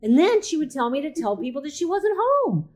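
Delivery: ordinary delivery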